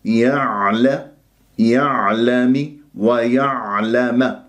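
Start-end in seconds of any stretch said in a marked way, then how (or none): 1.6-4.4 يعلم with a tight, swallowed ayn; correct mid-throat ayn. Shown as a demonstration. Teacher showing the correct ayn, slowly. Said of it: "لاحظتم الآن؟".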